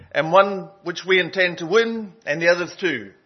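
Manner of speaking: high, loud presidential oratory; JFK. Retold or imitated. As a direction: imitate a rousing speech to a big crowd. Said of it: "do my best J F K, um, voice".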